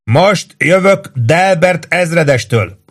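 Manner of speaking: slow, high shouted call; shouting up. A narrator's reading. Lieutenant shouting up to the roof during an air raid. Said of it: "kiabált föl Ross főhadnagy".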